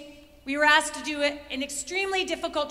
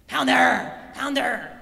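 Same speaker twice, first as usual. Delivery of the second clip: imitated exasperated yell, lower in pitch; mock yelling; 4 semitones lower, 5 dB louder, much slower than usual. Retold teammate yelling at her runner friend, mock yell. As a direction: yell the name like a fed-up runner. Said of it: "She's yelling at me now".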